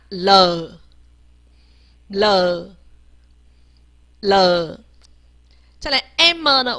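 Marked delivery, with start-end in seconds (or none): 0.1-4.8 了 as a low, drawn-out "luh"; 字母发音示范. Shown as a demonstration. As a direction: let 了 sink slowly. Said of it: "我们念一下了". (低而拉长地念“了”)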